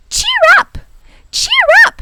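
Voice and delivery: piercing, very high loud bird voice; wiffle bird squawk. A narrator's reading. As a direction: squawk it out over the din. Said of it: "she squawked".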